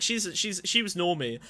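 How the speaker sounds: ordinary talk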